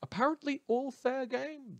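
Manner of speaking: high, mocking sneer; biting sarcasm. News host mocking the double standard, sarcastic and high. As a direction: toss it off with disdain. Sarcastic punchline after rant.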